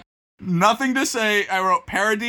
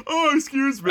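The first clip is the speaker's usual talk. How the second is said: high yawned apology; mock yawn; 7 semitones higher than usual. Tired cohost playing up his bit to partner, yawning. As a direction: yawn your way through an apology. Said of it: "tired today".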